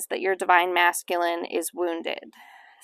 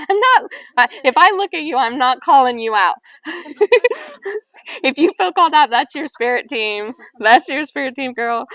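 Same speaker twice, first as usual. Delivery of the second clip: high, loud, laughing through the words; laughing reassurance; 7 semitones higher, 9 dB louder than usual. Real, not acted. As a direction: playfully protest to the group while cracking up. Teasing audience amid real laughter.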